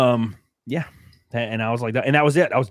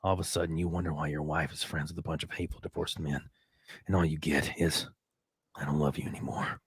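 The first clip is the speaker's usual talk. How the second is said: quoting Eric; 3 semitones lower, 5 dB quieter than usual. Retold or imitated. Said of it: "Eric says".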